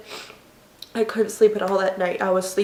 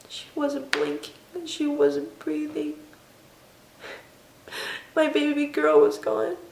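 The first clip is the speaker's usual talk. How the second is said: fighting back tears as she remembers; crying; 6 semitones higher, slower than usual. Real, not acted. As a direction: voice breaking, slow and tearful. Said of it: "I will cry".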